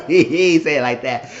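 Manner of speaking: loud, high, through laughter; real laughing confession. Real, not acted. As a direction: admit it while still cracking up. Laughing admission after exaggerated quote.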